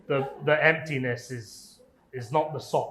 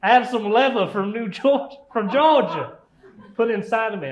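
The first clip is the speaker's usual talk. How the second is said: cowboy boots voice; 7 semitones higher, 6 dB louder than usual. Acted. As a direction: put on a weird cowboy accent, pitched way up. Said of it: "That's a weird accent".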